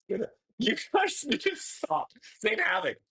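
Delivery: high-pitched squeal through laughter; overwhelmed protest. Real, not acted. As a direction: squeal helplessly at generous friends, half laughing. Overwhelmed by donations, protesting to chat.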